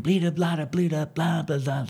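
quick scat syllables sung under the breath; imitated scatting. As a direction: mimic a guitarist's scat run, lightly. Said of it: "he starts scatting".

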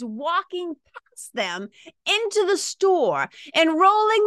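high, slow, indignant disbelief; judgy outrage. Real, not acted. To listeners, disbelief that nobody stopped to help, rising outrage. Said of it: "You can hear it in my voice".

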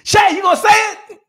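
high shouted challenge; loud teasing call to join in. Retold or imitated. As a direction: yell it across the pack. Runner calls out to him.

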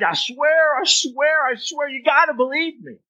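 high-pitched pleading to be believed; insistent swearing. Acted. Liar begging a listener to believe, high insistent plea. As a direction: pile on the oaths, high and urgent. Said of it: "no need for you to add".

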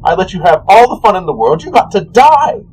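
high-pitched, put-upon whine of complaint; petulant god, rising to exasperation; aggrieved trickster god. Acted. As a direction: protest like a wronged host. Voices the aggrieved god Cyric.

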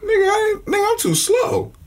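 outraged laugh, way up high; high protest. Real, not acted. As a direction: squeal it in protest. Friend teased, protests.

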